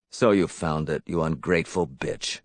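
low, venomous menace; hateful confrontation. A narrator's reading. Man catching Elizabeth with his box, low and hateful. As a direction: say it low with contempt.

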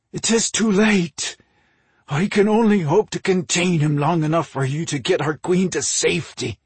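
high, loud, strained desperation; forced out. A narrator's reading. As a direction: force the words out past a tightening throat. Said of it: "Ore forced out".

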